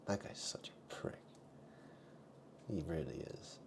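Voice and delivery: unvoiced whispered jab; hushed insult. Real, not acted. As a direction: whisper it as a snide aside.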